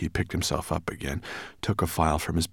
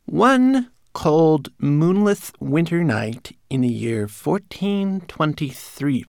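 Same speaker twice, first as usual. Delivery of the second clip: high, slow, declaimed tale; recited; 11 semitones higher, 7 dB louder, slower than usual. A narrator's reading. Manikin telling his master's legend, reciting high and slow. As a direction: recite grandly in a small servant voice. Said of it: "Twigleg obediently recited".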